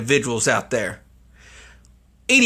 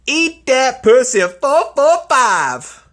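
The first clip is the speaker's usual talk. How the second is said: high, slow, louder announcement; mock announcement; 9 semitones higher, 5 dB louder, slower than usual. Real, not acted. Revealing the streamer's name as a mock announcement.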